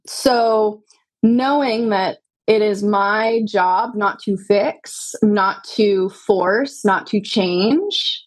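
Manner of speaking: slow, each phrase set apart with a rising end; slow, weighed list of what not to do; slow and deliberate. Real, not acted. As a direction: lay out each "not to" slowly. Listing what a doula avoids.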